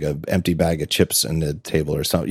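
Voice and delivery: ordinary talk, nothing marked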